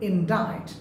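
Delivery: indict said as in-DITE, silent c; correct pronunciation. Shown as a demonstration. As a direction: say indict to rhyme with bite. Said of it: "they say". (indict-এ c অনুচ্চারিত, ইনডাইট)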